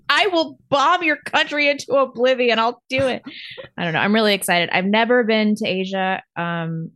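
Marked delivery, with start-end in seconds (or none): 0.1-3.2 mock threatening lady.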